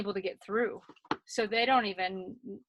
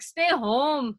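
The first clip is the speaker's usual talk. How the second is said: put-on Schwarzenegger accent; 4 semitones higher, 5 dB louder than usual. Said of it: "He's like".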